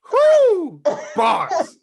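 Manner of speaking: loud, high whoop; real hyped cheer. Real, not acted. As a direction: cheer a killer rap line at full voice. Hyped reaction to the final line.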